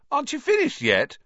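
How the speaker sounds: high, disbelieving call from the stands; can't believe they're still at it; incredulous call. A narrator's reading. Ron calling from the stands, incredulous. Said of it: "called Ron, incredulously".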